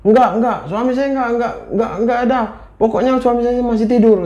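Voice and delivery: high, panicked angry refusal; storyteller acting a wife in hysterics; hysterical denial. A narrator's reading. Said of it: "Marah, histeris, panik".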